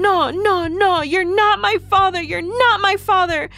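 high, loud tantrum scream; acted kid's screaming refusal; screaming child. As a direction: scream it in protest, pitch way up. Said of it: "he's screaming".

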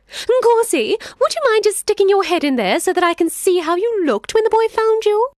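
sly jackal. Acted. Jackal pretending to leave the lion and boy, high and chirpy. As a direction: play innocent and fluttery.

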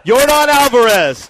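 loud, drawn-out emcee call; slow, big name announcement. Real, not acted. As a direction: announce the player to a cheering crowd. Calling names for crowd applause.

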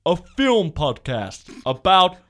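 slow, booming trailer announcer; movie trailer voice. Pretend. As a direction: roll it out like a trailer. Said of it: "should I do my movie voice".

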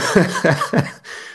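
a burst of real laughter; laughing at own joke. Real, not acted. Podcaster laughing at his own unplanned rhyme. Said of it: "this is me laughing again at my own jokes".

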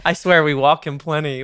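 ordinary talk, nothing marked

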